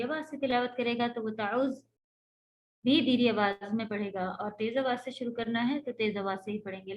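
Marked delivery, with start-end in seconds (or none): none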